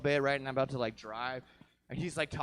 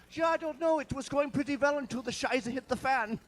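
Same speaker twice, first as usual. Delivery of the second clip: high, mocking German accent with Scheiße; 9 semitones higher than usual. Comic mocking a German-Argentine racer in German accent. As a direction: sneer it out in a put-on German accent. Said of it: "obnoxiously lives in Argentina".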